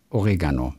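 Oregano stressed on RE; stress on second syllable. Shown as a demonstration. Podcaster corrects a spice name, stressed o-RE-gano. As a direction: punch the RE in Oregano. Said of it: "Auf zweite Silbe".

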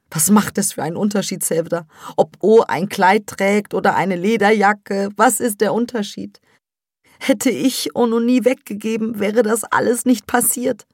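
high, loud, fast despairing mother; agitated mother. Acted. Mother blames herself to Sevda, high and loud. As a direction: pour out the guilt.

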